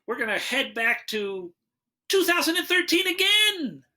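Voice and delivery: high, slow build to a big reveal; show host announcing. Pretend. Host introducing the next bad-movie segment, grand rising announcement. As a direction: announce it grandly, climbing in pitch.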